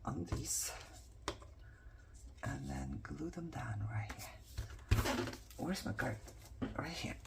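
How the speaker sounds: slow, unvoiced murmur to herself; whisper. Real, not acted. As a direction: whisper the steps slowly. Whispering while gluing.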